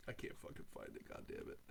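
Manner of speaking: whispered annoyed cursing; frustrated mutter. Real, not acted. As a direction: grumble under breath.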